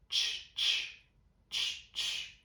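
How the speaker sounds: "Tch, tch" hissed as an unvoiced t-ch burst with no voice; voiceless final t sound. Shown as a demonstration. Teacher showing students the voiceless final sound, unvoiced. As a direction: breathe out "tch" with no vibration in the throat. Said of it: "o som do match no passado vai ser com T".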